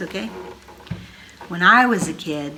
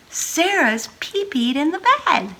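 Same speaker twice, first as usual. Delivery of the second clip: chorus announcement; 9 semitones higher, slower than usual. Acted. Family says it together.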